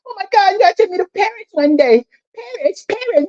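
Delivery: high-pitched gushing imitation of mama; mock starry-eyed mama. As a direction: put on mama's dreamy, high squeal. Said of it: "Even my mama".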